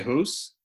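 "hoose" with a tight oo vowel; Canadian "house". Shown as a demonstration. Admitting a quick-speech habit, Canadian "hoose". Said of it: "if I'm talking quickly".

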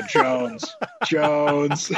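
announcer call. Retold or imitated. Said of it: "just go".

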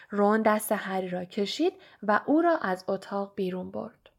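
ordinary delivery, nothing marked

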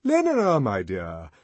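calling Lenina. A narrator's reading. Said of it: "he called in another tone".

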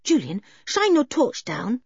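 put-on tomboy girl's voice. George's quoted speech.

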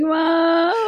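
high, drawn-out wail for mother; hammed-up tearful cry; mock crying. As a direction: pretend bawling kid calling out. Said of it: "I'm screaming and crying even louder now".

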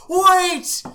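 high yell to stop the bus; screaming. Retold or imitated. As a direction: shout it out high and loud. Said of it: "guys are just screaming at the".

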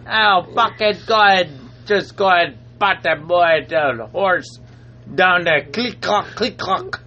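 halting fake Jamaican accent; bad Jamaican accent. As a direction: persist in a dreadful Jamaican accent. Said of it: "I'm cringing".

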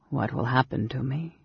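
usual speaking voice

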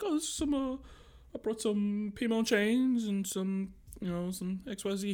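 high, hesitant awkward guy; retold awkward, high mumbling reply; super awkward. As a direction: imitate a nervous guy fumbling for words. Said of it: "He was, like, super awkward".

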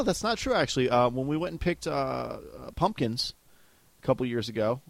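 normal talking voice

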